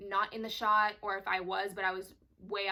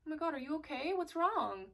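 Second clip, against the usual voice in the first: higher, gently worried asking; caring concern; 7 semitones higher than usual. Retold or imitated. Concerned friend asking if you're okay, caring. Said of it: "somebody comes up to you and they're like".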